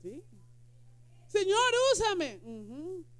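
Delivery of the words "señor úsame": mock pleading prayer. Imita oración pidiendo.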